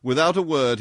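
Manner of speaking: plain reading voice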